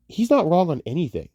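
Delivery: normal talking voice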